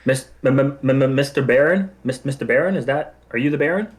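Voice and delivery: nervous stammer. Acted.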